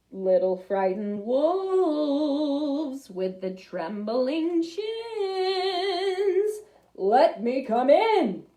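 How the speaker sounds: slow, drawn-out wheedling big bad pig; sly pig voice. Big bad pig coaxing the scared wolves, slow and wheedling. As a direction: coax the wolves to open up.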